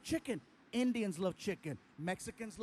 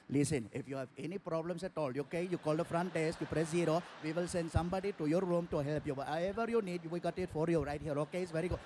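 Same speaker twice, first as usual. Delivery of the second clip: put-on Indian accent; 5 semitones lower, faster than usual. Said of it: "He's like".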